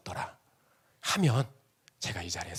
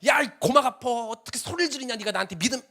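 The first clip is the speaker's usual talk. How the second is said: mock annoyed yell; 11 semitones higher than usual. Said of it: "막 이렇게 안 했어요".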